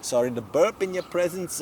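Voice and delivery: high, loud burp and polite apology. Acted. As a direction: belch then apologise grandly. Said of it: "he's like".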